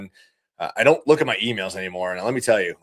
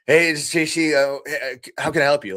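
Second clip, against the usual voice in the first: higher-pitched, halting phone answer, worn out; miserable, harried greeting; 4 semitones higher than usual. Acted. Installer sweating on a roof answers a customer's call, harried and miserable. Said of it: "sweaty and freaking dying and miserable".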